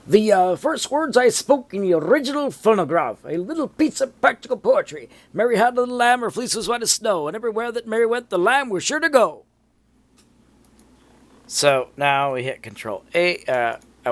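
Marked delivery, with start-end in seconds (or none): 0.1-9.4 put-on high, old-time Edison voice; Thomas Edison. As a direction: recite it like an old inventor. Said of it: "Edison's little speech".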